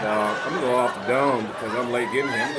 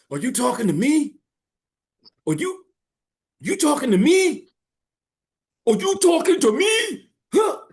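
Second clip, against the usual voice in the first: high, loud in-your-face challenge; Robert De Niro; 6 dB louder than usual. Acted. Quoting a confrontational sidewalk movie scene, in-your-face impression. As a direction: get right up in their face, voice pitched way up. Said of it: "all in their face".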